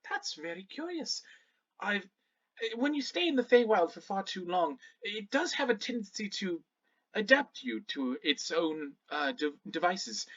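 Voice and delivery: a touch of a puzzled scholar; lightly voiced Shannara, musing. Shannara pondering the Feywild's effects, musing politely. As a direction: think aloud, explaining with polite hesitations.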